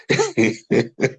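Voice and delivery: amused rippling chuckle; real laughter. Real, not acted. Picturing twenty-five-year-old wedding dresses, chuckling helplessly. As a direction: let a giggle escape mid-talk. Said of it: "let me laugh very well".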